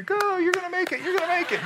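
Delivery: very high-pitched mock cheer. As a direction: squeal encouragement like a comic supporter. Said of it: "We were cheering for Peter".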